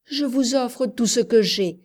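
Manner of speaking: plain reading voice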